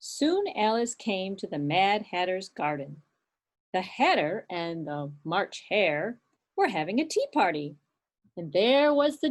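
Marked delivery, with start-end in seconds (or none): none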